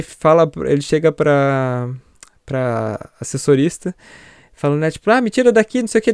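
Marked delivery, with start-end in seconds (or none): 5.1-6.1 much higher, pleading mock panic; begging to get out, half-acted; retold panic. Man trapped in haunted elevator. (pânico imitado, bem mais agudo)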